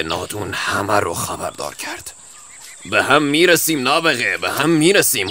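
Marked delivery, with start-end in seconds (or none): 2.9-5.3 parting threat. Acted.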